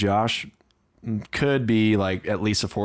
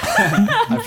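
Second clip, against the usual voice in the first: laughing; 5 dB louder than usual. Real, not acted. Numb mouth, laughing guest.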